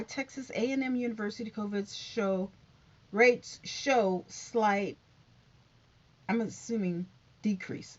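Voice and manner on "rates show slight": ordinary talk, nothing marked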